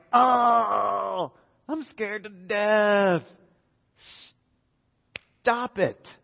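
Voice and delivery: high, slow, cried-out mock fear; hammed-up scared wail; mock terror. Disciples in the storm crying out, hammed-up fear. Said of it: "you're like".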